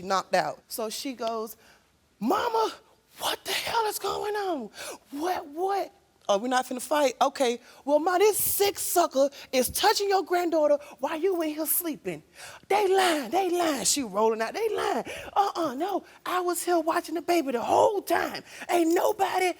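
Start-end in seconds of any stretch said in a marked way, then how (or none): 2.2-12.2 angry confrontation. Retold or imitated. Sister confronts sleeping mother. 12.7-19.5 shouted denial. Retold or imitated. Mother loudly denies.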